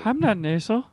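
softly put-on Jeff voice, lightly defensive; a mild gentle denial in character; quiet light Jeff persona; Jeff impression. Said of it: "Is that a good Jeff impression?".

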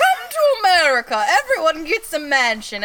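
loud, high tourist-ad holler; over-the-top promo call; mock sales pitch. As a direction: sell America like a huckster.

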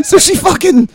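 high, loud, talking through real laughter; laughing. Real, not acted. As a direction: try to carry on while cracking up. Trying to resume after cracking up.

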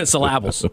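"Syllables" said as suh-LAB-ulls, amused; playful mispronunciation. Pretend. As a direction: stress the middle of "Syllables" with a short a, like LAB. Playful mangling of the word, laughing.

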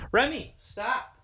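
quiet slow telling-off of the dog; soft scold to dog. Real, not acted. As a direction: hush the dog without breaking the mic.

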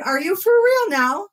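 high, slow disbelief; are you serious, drawn out; incredulous. Retold or imitated. Host reacting to a rival's live video, incredulous. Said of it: "And I was like".

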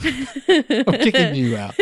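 high, slow, laughing through it; laughing mock eviction. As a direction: fake banish while cracking up.

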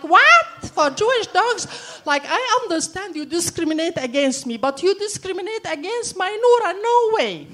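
high, loud outraged disbelief; indignant shout at the vet. Retold or imitated. Outraged at vet's rule.

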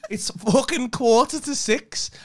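loud, high, slowed-down exasperated shouting; exasperated rant. Real, not acted. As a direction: vent the frustration at full volume. Partner ranting at girlfriend about lateness.

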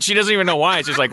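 ordinary talk

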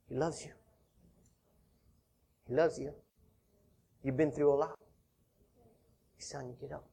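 almost breathe it to them; tender hush. Real, not acted. Preacher softly assuring listeners God loves them, hushed.

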